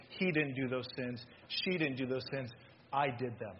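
low, soft, solemn admission; quiet intercession. Acted. Jesus pleading for sinners before the Father, quietly solemn.

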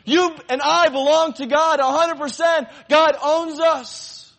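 loud, high, impassioned preaching; preaching at full force. Acted. Preacher calling out to his congregation, impassioned and loud. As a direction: proclaim it to the whole room. Preacher proclaiming opening truth.

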